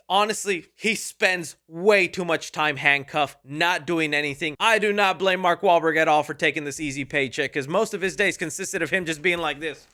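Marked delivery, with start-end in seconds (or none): none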